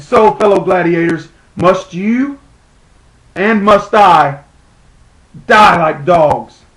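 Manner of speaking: loud, drawn-out words with heavy pauses; bitter exhortation. Acted. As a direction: rouse fellow gladiators with slow, weighty questions. Rousing the gladiators.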